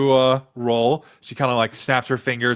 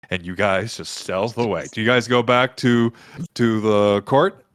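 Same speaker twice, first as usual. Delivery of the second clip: ordinary talk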